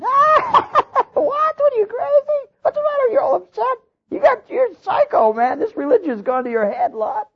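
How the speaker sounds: very high squeaky mocking kid voices. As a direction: shriek in disbelief like kids mocking their dad. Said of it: "The kids are going".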